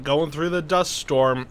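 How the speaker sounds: normal talking voice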